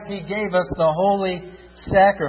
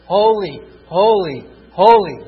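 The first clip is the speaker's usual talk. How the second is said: each holy louder, building to a shout; crying out holy; 8 dB louder than usual. Acted. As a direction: cry it out like angels in praise. Angels' praise cried out in sermon.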